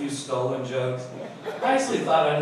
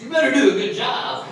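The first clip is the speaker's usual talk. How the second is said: stern cop warning; 7 semitones higher, 7 dB louder than usual. Retold or imitated. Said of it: "she goes".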